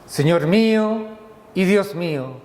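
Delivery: ordinary talk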